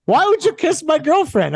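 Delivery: high, indignant demand; hammed-up jealous boyfriend; mock outrage. Jealous boyfriend confronting his friend over the kiss, mock outraged. Demergis confronting him angrily.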